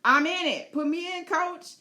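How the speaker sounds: high, keen and raring to fight; pumped-up beast begging to join in; eager dragon voice. Pretend. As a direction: play the dragon itching to jump into battle. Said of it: "He's like".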